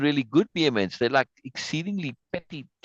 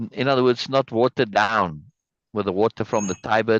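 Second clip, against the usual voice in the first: normal talking voice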